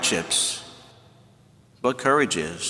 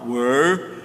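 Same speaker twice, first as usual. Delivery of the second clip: lightly voiced caveman asking where; a hint of a Stone Age man; mock caveman question; Flintstone voice. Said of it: "That's the way he says it".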